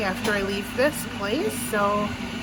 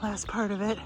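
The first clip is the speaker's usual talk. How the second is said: out of breath, breathy and soft; shallow breathing; 7 dB quieter than usual. Real, not acted. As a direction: talk between short breaths after a climb. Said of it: "Excuse my breathing".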